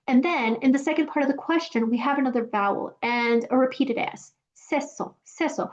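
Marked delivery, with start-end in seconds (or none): 4.5-5.7 "seso" from "es eso" with the vowels merged; soft demonstration; fused syllables. Shown as a demonstration. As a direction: glide the S into the next vowel. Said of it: "kind of sounds like queso".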